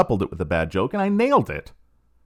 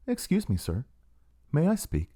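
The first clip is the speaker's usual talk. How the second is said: soft, falsely meek "Excuse me"; mock humble; 4 semitones higher, 4 dB quieter than usual. Professor retelling interrupting the speaker, mock humble and soft. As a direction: play it mock-meek and small. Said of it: "And I'm so humble".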